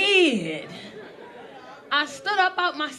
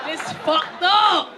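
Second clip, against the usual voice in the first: drawn out; much slower than usual. Real, not acted. Punchline, drawn out.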